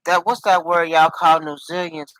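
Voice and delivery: ordinary talk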